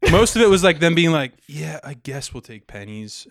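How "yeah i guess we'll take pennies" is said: soft, slow, resigned mock reply; demoralized. Imitating car salesmen accepting pennies, quietly demoralized. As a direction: imitate someone grudgingly giving in, quiet and drawn out. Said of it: "demoralized by it".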